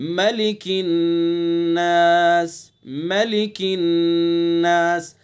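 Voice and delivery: नास held long through the nose in recitation; nasal ghunna. Shown as a demonstration. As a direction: hum the नून of "मलिकिन नास" through the nose. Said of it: "किस तरह से?". (नाक में गुनगुनाकर नास)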